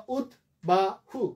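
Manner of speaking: উদ্বাহু as ud, ba, hu, spaced apart; three-part split. Shown as a demonstration. Teacher offering another division, three separate syllables. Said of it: "অথবা".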